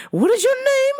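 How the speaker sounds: high-pitched mock English caller; phony English accent. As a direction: repeat the question high, in fake English. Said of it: "phony English accent".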